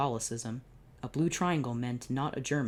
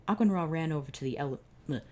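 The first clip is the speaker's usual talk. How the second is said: usual speaking voice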